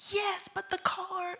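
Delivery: high, fretful retold reply; freaking out. Wife on the phone worrying about the car, mildly frantic. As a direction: mimic yourself still worked up, pitched high. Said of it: "So I call him and I'm freaking out".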